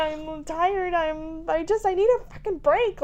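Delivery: high, loud, exhausted outburst; ambushing rant. Retold or imitated. Mom ambushing her husband after a hard day, high and exasperated. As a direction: unload every frustration at once. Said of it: "Just like".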